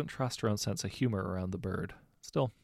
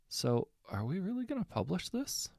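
hesitant; 10 semitones higher than usual. A narrator's reading. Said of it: "Bill asked hesitantly".

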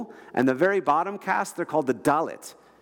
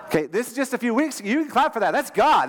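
rousing excitement; 7 semitones higher than usual. Real, not acted. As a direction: fire the crowd up to applaud. Preacher rousing crowd to clap.

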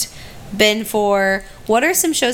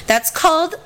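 usual speaking voice

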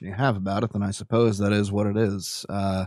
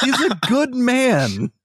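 in-character earnest; 13 semitones higher, 3 dB louder, much slower than usual. Acted. In-character, slow, high.

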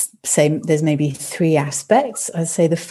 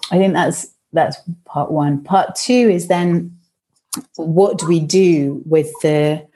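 normal talking voice

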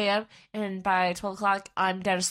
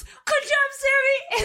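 loud, very high squeal; imitated praise, pitched way up; hammed-up congratulation; 5 dB louder, much slower than usual. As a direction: cheer for a friend in a squeaky mock voice. Imitates Dylan's reply amid laughter.

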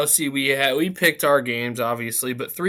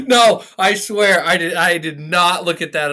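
excited protest; 6 semitones higher, 9 dB louder than usual. Real, not acted. Protesting he didn't look.

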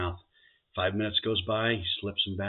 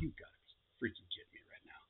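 whispered, much softer; whispered disbelief; 2 semitones higher, 16 dB quieter than usual. Acted. Groom hearing about thirds, whispering in despair. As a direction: hiss it out, beaten. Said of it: "And he says".

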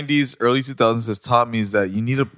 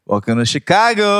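sarcastic welcome; 9 semitones higher, 5 dB louder than usual. Pretend. Sarcastic welcome to traffic jam.

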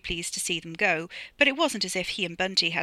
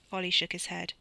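ordinary delivery, nothing marked

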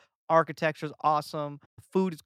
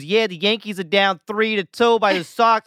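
nosy stranger; 3 semitones higher, 11 dB louder than usual. Retold or imitated. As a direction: imitate a stranger butting in loudly. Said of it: "a stranger pulls out his phone and goes".

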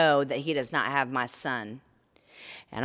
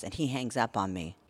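ordinary talk

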